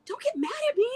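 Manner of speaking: a hint of a nervous waiter; mock pleading waiter. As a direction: play the waiter backing off. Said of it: "the guy goes".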